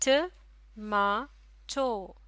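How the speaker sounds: Tomato in three separate beats, ट, मा, टो; syllable by syllable. Shown as a demonstration. Teacher splitting a word into syllables for learners, slow and separated. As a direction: break tomato slowly into its syllables with clear gaps. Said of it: "तीन आवाज़ों में से तोड़ा जा सकता है".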